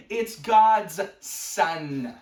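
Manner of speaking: loud, very drawn-out bellow; mock bellow. Exploding at the clueless friend, mock bellow. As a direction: stretch each word into a hammed-up roar. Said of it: "I bellow".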